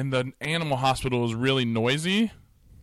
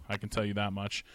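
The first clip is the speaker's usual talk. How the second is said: usual speaking voice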